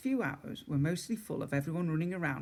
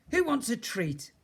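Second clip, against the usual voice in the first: 1 talking to a pet; 9 semitones higher, 4 dB louder than usual. A narrator's reading.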